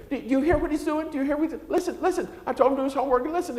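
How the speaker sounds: high-pitched exasperated mom voice; complaining mom. Retold or imitated. Mother calling to complain about her son, high mimicking whine. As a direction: mimic a frantic mother on the phone. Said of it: "call me up and say".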